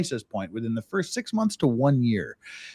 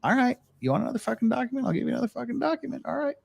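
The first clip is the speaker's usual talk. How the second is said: higher, softer, fast resigned grumble; grudging compliance; 10 semitones higher, 7 dB quieter, faster than usual. Retold or imitated. Expat grudgingly giving the officer the document, soft fast grumble. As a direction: give in through your teeth, fast and soft. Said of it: "Or are you gonna go".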